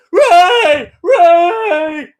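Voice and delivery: high, loud, strained calling of a name; hammed-up cry for Rey; mock desperate calling. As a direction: yell the name over and over like a desperate hero. Mocking Ben calling for Rey.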